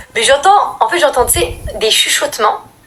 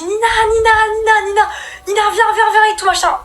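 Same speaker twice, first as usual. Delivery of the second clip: high, urgent, tearful calling of a name; panicked calling; 12 semitones higher, 3 dB louder than usual. Retold or imitated. Little girl waking her sister, high panicked pleading.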